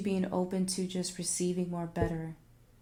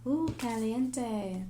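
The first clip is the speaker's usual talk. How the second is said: playful caliente; 3 semitones higher, much slower than usual. Acted. Reacts to a hot card.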